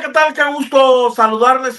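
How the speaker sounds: ordinary talk, nothing marked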